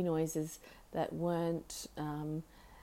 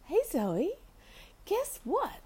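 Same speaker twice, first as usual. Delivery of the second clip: talking to the bird; 12 semitones higher, slower than usual. Real, not acted. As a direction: coo up high at the parrot. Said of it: "I'm gonna talk like I'm talking to the bird".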